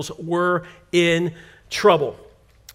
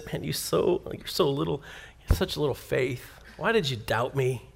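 quiet, lower gentle rebuke; 7 semitones lower, 11 dB quieter than usual. Acted. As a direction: say it softly, like a disappointed friend. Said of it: "And he says".

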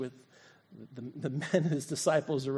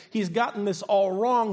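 usual speaking voice

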